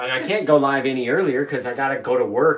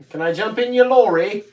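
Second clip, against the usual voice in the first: higher, louder mock British; terrible British accent; 8 semitones higher, 5 dB louder than usual. Co-host playing passenger asking for a lift, bad British accent. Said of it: "we're sorry for the terrible accent".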